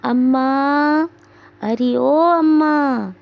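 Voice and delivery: slow, loud, high call across the house; panicked call for mother. Acted. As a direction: stretch it out to summon mother. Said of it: "अपनी माँ को आवाज़ देती है".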